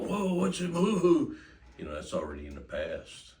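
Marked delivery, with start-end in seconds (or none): 0.0-1.3 much higher, louder spooked cry; startled dog voice. Pretend. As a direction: voice the spooked dog, high and loud. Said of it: "the dog, you know".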